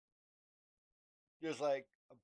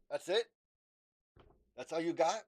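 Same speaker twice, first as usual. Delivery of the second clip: louder, mocking dare; taunting challenge; 4 semitones higher, 6 dB louder than usual. Arms folded, taunting the entity mockingly. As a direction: throw it down like a challenge. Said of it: "and I say".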